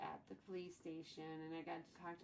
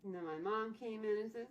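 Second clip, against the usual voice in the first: normal talking voice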